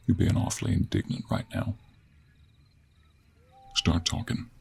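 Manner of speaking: hushed, controlled order; quiet stern demand. Acted. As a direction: press them softly but firmly. Sternly questions the bound captive.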